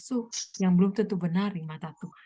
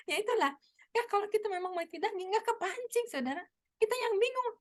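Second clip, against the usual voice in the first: ordinary talk, nothing marked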